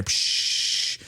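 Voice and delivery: long hissed hose noise; imitated water spray. Man hosing the stove fire, spraying sound. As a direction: make the sound of squirting water.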